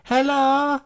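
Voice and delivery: put-on high squeaky young boy voice, eager and loud; squeaky kid gamer. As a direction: play an overexcited twelve-year-old fan. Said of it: "It's like a twelve, thirteen-year-old kid".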